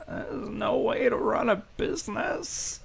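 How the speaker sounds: high, soft grumble; hushed, high-pitched character grumble; muttering. Acted. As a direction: mutter disapproval to yourself. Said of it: "and he mutters".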